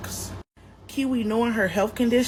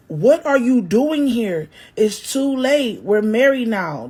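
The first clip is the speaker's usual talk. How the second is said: loud, slow, higher yell; 3 semitones higher, 7 dB louder than usual. Acted. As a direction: shout her down, word by word. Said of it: "Tone yelled".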